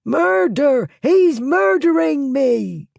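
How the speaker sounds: high, loud shrieking; thief yelling murder. A narrator's reading. Thief shrieking murder as Blackwood drags him into the yard. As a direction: shriek for the neighbours to hear.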